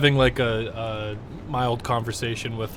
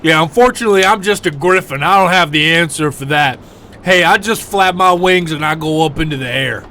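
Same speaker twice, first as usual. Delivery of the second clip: griffin voice; 6 semitones higher, 9 dB louder than usual. Acted. Griffin answers about flying.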